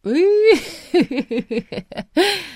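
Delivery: high delighted peal of laughter. Acted. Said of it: "Зашлась в смехе довольная Ба".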